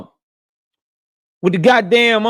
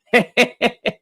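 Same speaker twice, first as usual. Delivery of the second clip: short real laugh at the news; quick amused laugh. Real, not acted. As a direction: laugh out at how funny it is. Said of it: "Oh, that's hilarious".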